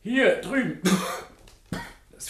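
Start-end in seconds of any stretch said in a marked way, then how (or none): 0.0-0.8 high, raised answer across the room; calling back. Acted. Professor answering the doctor's call, raised and high. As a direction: call out so he can find you.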